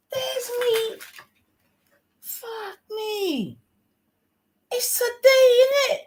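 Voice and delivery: very high-pitched squealing panic; shocked realisation. Real, not acted. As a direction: squeak it out as it dawns on you. Suddenly realises the match is today.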